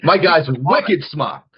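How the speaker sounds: put-on high, drawn-out Boston accent parody; mock Boston accent. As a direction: stretch it out in a joke Boston accent. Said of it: "with that accent".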